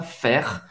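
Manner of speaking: "Faire" low and soft, R lightly scraped in the throat. Shown as a demonstration. Teacher showing the French R, lightly scraped in the throat. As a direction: scratch the R at the end of "Faire". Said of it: "arranhar um pouquinho essa garganta".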